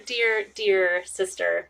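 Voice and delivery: campy drag queen. Acted. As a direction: lay it on thick, darling. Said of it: "Hello, darlings. I am Clarissa".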